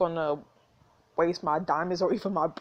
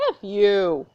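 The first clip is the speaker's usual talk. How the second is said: defiant; 7 dB louder than usual. Real, not acted. Insult said loud.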